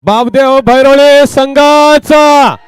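high, long-drawn team name; announcer proclamation. Acted. Host hailing the winning team, drawn-out announcer call. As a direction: stretch the name out for the crowd.

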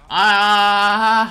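drawn-out "ah" breaking into a cackle; long, loud staccato laughing cry; loud laughing wail. Real, not acted. Reacting to botched timing.

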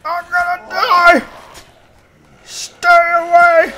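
high, loud panic scream; panicked yelling. Real, not acted. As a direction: yell in panic to keep attackers off. Panics under zombie attack.

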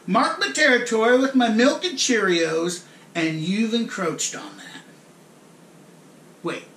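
ordinary delivery

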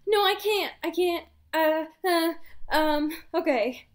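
high, flustered stammer giving in; mock panicked refusal that caves into okay; flustered Edward. Edward told to go home without Bella, flustered high stammer. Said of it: "And he's like".

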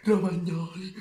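high excited shout of the name. Acted. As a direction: yell the name like a goal call. Goal call, contrasted with whisper.

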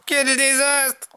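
slow, high-pitched wail of dismay; moaning Tom. A narrator's reading. Tom sees the disaster, high slow moan. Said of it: "Gémit Tom".

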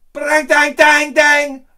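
loud high clang noises; imitated clanging. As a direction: bang it out like a metal dish. Imitates dish slamming floor.

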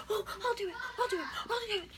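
high, mock-eager boy voice; squeaky retold eagerness; eager volunteer. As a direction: imitate a smitten boy jumping to volunteer.

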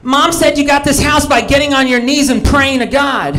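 put-on high, eager little kid voice; son's voice. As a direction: play the excited young son tattling to dad. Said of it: "he'd be like".

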